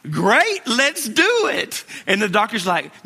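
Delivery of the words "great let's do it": high, slow, tight mock eagerness; pushing out brave words through nerves; strained forced cheer. Father forcing cheer, nurse pressing.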